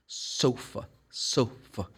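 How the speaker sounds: softly, "sofa" ending in a weak uh; unstressed schwa. Shown as a demonstration. Teacher demonstrates schwa in sofa, soft unstressed ending. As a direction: let the last vowel of "sofa" relax to uh. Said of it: "schwa sounds normally is unstressed".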